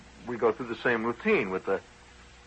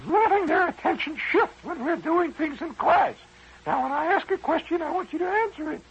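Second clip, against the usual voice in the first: high, prim, stern schoolteacher scolding; scolding teacher. Retold or imitated. Teacher scolding the daydreaming boy in a high, stern voice.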